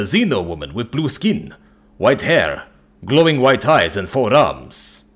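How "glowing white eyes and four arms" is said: ordinary delivery, nothing marked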